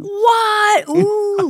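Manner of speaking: a whole table gasping in disbelief; shocked table. Retold or imitated. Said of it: "the whole table erupted in".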